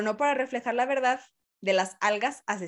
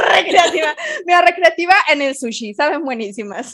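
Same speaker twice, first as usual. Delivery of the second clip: laughing; 6 semitones higher, 9 dB louder than usual. Real, not acted. Joking while laughing.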